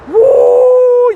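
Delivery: a high burst of laughter into a loud woo; laughing whoop. Real, not acted. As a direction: crack up and whoop. Laughing at own advice to Jacob.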